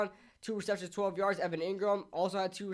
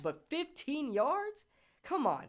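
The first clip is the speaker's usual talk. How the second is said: incredulous; 8 semitones higher, slower than usual. Real, not acted. Ranting about a penalty.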